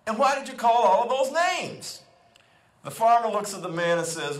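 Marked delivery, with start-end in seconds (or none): 0.1-2.0 high-pitched, astonished questioning; amazed, raised voice in disbelief; simply amazed. Acted. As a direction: act out a man baffled by what he just saw. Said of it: "The man is simply amazed".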